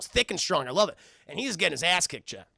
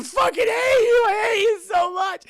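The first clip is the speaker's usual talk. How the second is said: much higher and louder, crying rage; jilted bride screaming hatred, crying; sobbing furious scream; 8 dB louder than usual. Retold or imitated. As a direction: scream it through tears. Jilted fiancee screams at greedy man.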